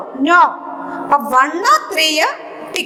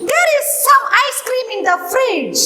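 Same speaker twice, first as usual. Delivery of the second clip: higher, slow and deliberate reading; slow high dictation; 6 semitones higher than usual. Shown as a demonstration. Teacher dictates a workbook sentence to pupils, slow and higher. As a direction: read it out carefully for the children. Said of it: "dictating sentence to class".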